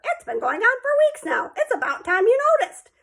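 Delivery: parrot voice. Retold or imitated. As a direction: voice the talking parrot, cheeky. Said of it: "expected Uncle Feather to answer".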